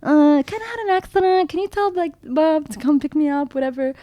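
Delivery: high-pitched, playing down bad news; feigned casualness. Calling sister after an accident, high faux-casual voice. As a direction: break it lightly in a high voice.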